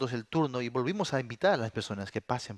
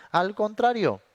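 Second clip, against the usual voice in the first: higher echo; 10 semitones higher, 6 dB louder, slower than usual. Shown as a demonstration. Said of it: "No, al contrario".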